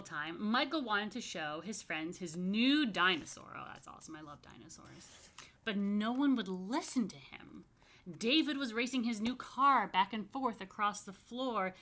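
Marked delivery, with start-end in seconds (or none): none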